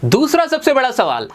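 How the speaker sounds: usual speaking voice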